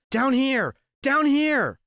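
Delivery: high, loud call for attention; urgent repeated yell. A narrator's reading. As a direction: call up to someone far away, pitch leaping. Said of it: "I yelled".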